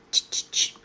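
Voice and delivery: unvoiced ch-ch-ch door knock; knocking sound made with the mouth; imitated knocking. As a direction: mimic a sharp, rapid knock.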